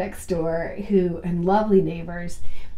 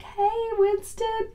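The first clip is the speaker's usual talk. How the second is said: sweet greeting; 12 semitones higher, 5 dB quieter, slower than usual. Retold or imitated. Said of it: "they're like".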